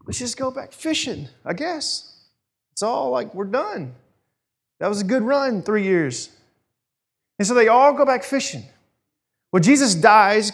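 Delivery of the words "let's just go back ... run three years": high, soft, deflated voice; resigned giving-up. Retold or imitated. Disciples give up and return to fishing, resigned. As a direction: shrug it off in a small high voice.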